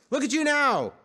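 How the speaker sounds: high, loud jeer; mocking yell. A narrator's reading. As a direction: yell it in mockery at him. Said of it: "they yelled at him".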